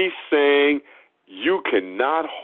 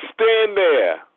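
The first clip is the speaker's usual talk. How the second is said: higher-pitched mock command; dismissive order; 6 semitones higher than usual. Preacher mimics brushing off the poor person, dismissive. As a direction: send the poor visitor off to stand.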